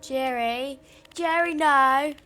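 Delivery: loud desperate screams of a name; frantic yelling. Acted. Player screaming at Jerry to stop. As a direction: yell for Jerry in panic.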